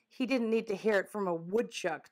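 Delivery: plain reading voice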